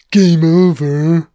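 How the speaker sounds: slow, loud, stretched game over; Hudson impression, drawn out. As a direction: drag game over out in dismay. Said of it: "it's Hudson".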